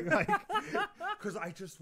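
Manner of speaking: high laughing burst, loud. Real, not acted. Telling the podcast host about the show, laughing hard. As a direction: break into delighted laughter.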